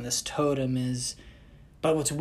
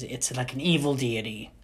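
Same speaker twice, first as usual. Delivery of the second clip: ordinary talk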